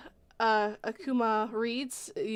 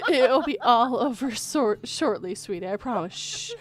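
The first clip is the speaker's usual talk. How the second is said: mock tender killer reassurance; creepy soothing voice; 4 semitones higher, 8 dB louder than usual. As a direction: soothe a victim toward the light.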